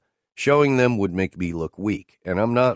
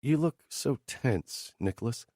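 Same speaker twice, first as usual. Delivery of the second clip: coaxing Teresa; 5 semitones higher, 6 dB quieter than usual. Acted. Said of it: "she says, as she bends".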